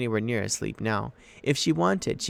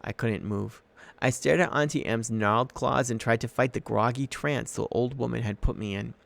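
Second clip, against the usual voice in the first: plain reading voice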